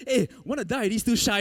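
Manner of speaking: high, loud half-laughing call; mock shout for help. Preacher retelling the shout he held back, hammed-up and loud. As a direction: yell it out, then catch yourself. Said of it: "embarrassed to shout".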